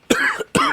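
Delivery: sharp real coughing fit. Real, not acted. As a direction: choke and cough hard. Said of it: "choked on that".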